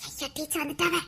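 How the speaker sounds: very low, barely voiced alarm; secretive, hushed little panic; hushed warning. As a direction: warn friends under your breath. Pupils warn teacher is coming.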